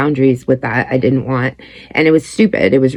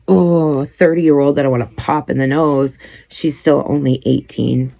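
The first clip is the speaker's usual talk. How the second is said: normal talking voice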